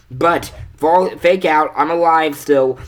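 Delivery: loud, excited fake-out; spring the surprise loudly; twist reveal. Narrator springing a joke twist on chat, loud and excited.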